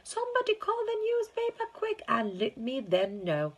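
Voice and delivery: excited shouting. Acted. Shopkeeper excited, calling out.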